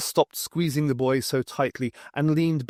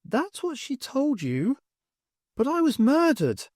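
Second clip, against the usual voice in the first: put-on sad ghost girl; 13 semitones higher than usual. A narrator's reading. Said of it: "shook his head sadly".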